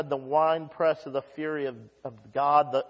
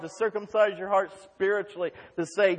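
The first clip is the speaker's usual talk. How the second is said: normal talking voice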